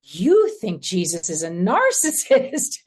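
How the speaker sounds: slow, loud, incredulous retold exclamation; astonished realization. Spiritual director confronting directee with insight, astonished realization. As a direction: stretch it out as a stunned discovery.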